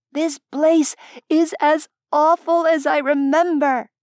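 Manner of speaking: high, loud gleeful female voice over music; gleeful Zora. A narrator's reading. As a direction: shout it gleefully over the din. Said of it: "she said gleefully over the din".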